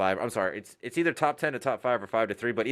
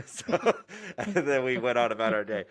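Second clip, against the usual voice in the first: higher, laughing as it starts; 3 semitones higher than usual. Real, not acted. RDC wrapping up story of firing recruits, laughing. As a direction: laugh your way into the wrap-up.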